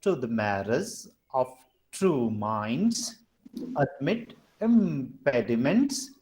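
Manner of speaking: low, measured reading of verse in feet; metrical verse reading. Shown as a demonstration. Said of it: "this will be read as this".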